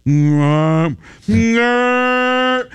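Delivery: mock bear groan, rising high on the last no; lightly voiced bear protesting its lost treat; a hint of an angry baby bear; imitating the bear. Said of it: "this is the sound he made".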